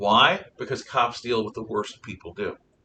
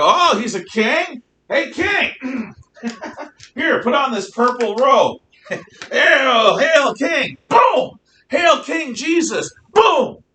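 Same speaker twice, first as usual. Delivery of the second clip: high, loud jeering soldiers laughing and shouting hail; mocking soldiers; 14 semitones higher, 10 dB louder, slower than usual. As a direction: jeer at the prisoner like a brutal soldier. Soldiers mocking Jesus, acted.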